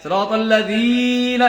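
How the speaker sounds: সিরাতাল্লাযীনা rushed up to a dragged "dhee"; overstretched mistake. Shown as a demonstration. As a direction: show the fault, over-holding যী in সিরাতাল্লাযীনা. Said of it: "এরকম যেন হয়".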